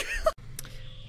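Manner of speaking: short squeaky burst of laughter; brief high-pitched giggle. Real, not acted. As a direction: laugh high right after the outburst. Laughs after shouted curse.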